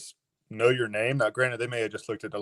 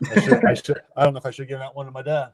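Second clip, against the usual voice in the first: laughing; 3 semitones higher, 6 dB louder than usual. Real, not acted. Joking about giving glove away.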